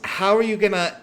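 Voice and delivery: puzzled protest. Acted. Girl questions the fake death plan, high and puzzled. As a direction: start a high objection and break off.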